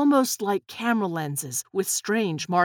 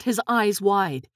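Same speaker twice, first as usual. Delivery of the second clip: plain reading voice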